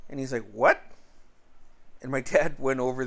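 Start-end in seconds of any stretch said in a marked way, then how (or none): 0.5-0.8 louder, rising mock outrage; retold shocked question. Dad hears his kid was threatened, retold sharp disbelief. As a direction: act out a sudden disbelieving what.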